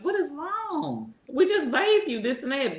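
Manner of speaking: high-pitched worry over the huffing dog; fretting at the dog. Retold or imitated. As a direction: owner fussing at her sick dog. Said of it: "And I was like".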